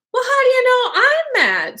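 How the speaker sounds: put-on high-pitched indignant storybook girl; Alice. A narrator's reading. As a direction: challenge it in a high character voice. Reader voices Alice challenging the cat.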